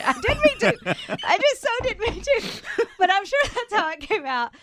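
laughing high and loud through the words; real giggles breaking into every phrase. Real, not acted. Host laughing while telling the radio hosts about her blunder. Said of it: "I get so tickled".

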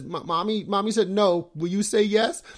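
put-on high, loud little-girl wheedling; imitated pleading daughter. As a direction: mimic a kid begging the other parent. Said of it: "then she goes and, and asks the other one, says".

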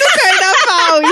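slow, loud, stretched punchline; hammed-up slow reveal; drawn out punchline. Real, not acted. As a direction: draw it out for the laugh. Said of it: "punchline of her story".

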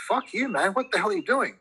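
real exasperation, voice leaping upward; exasperated outburst. Real, not acted. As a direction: pitch jumps up high in disbelief.

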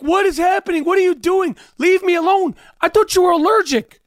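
high, shouted mock panic; imitating the lady yelling at marshals; panicked lady. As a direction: squeal it like a confused passenger hauled off. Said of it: "The ladies are like".

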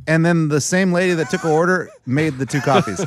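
ordinary talk

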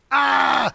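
high, loud imitated scream; mock passengers' scream. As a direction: cry out like a crashing passenger. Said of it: "the driver and the passenger going".

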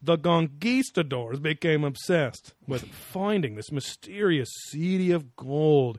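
slow, drawn-out pundit impression; quoted pundit voice. Host quotes the show's talk of lost gold city, slow impression. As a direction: linger grandly on the key words. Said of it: "he then continues and he goes".

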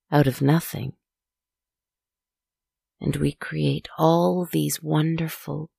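plain reading voice

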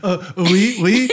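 high fake-French hesitation; mock French accent. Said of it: "With a terrible accent".

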